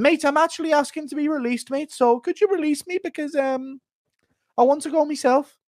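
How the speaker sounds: high-pitched insistent pleading; Darren Till. Acted. Fighter asking to be released from the UFC, high insistent impression. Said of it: "Impersonate Till wanting a release from the U F C".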